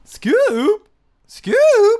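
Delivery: very high, loud Shaggy attempt. Shown as a demonstration. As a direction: try the squeaky cartoon stoner. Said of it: "do it".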